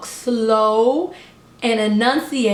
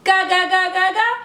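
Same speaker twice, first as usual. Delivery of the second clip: mock babbling baby talk; 5 semitones higher than usual. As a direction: babble it back like baby language. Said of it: "it's so easy to say".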